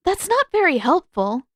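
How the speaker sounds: high loud fed-up protest; loud high exasperated retort; exasperated complaint. Acted. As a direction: scold him for the useless advice. Katara exasperated.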